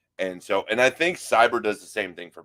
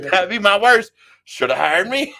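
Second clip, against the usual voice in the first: high, loud, amused delivery; cracking up at own joke; laughing; 10 semitones higher, 6 dB louder than usual. Real, not acted. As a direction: say it through laughter, pitched up.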